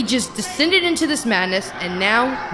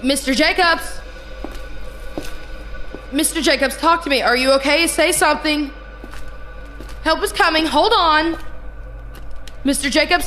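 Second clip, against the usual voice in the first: urgent, higher-pitched calling out; panicked nurse seeking any response; alarmed; 3 semitones higher than usual. Acted. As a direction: call out in rising alarm. Nurse calls to silent patient.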